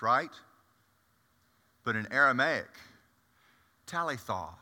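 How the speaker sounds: ordinary talk, nothing marked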